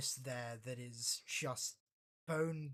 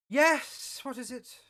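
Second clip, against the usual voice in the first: higher and louder, curt and irritated; officer annoyed at being disturbed, impatient; impatient answer; 10 semitones higher, 13 dB louder, slower than usual. Acted. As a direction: snap at the interruption. Answers interrupting console call.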